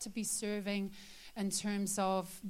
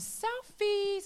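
playful stress; 12 semitones higher, 4 dB louder than usual. Acted. Playful emphasis on Filipinos.

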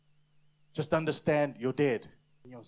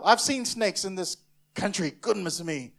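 usual speaking voice

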